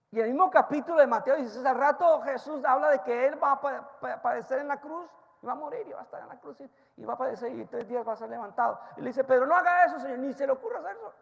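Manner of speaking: loud, fast, high sermon delivery; full-force preaching. Acted. Preacher retelling Peter's story to the congregation, loud and fast. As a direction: drive the story home at the top of your voice.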